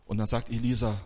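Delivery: normal talking voice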